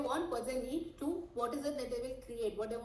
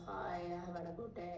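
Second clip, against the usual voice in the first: much softer, lifeless reply; flat dead tone; 3 semitones lower, 7 dB quieter than usual. Shown as a demonstration. Coach showing the wrong flat reply. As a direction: answer flat and drained. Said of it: "That's not how you talk".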